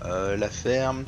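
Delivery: usual speaking voice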